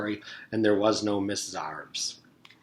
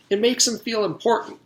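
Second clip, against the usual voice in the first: higher pitched eager exclaim; a proud kid's excited claim; 11 semitones higher than usual. Acted. Jason explaining the fish's name to teacher, exclaiming. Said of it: "Jason exclaimed".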